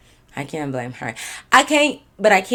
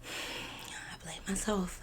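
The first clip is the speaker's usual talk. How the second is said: whispered self-blame; 18 dB quieter, much slower than usual. Real, not acted.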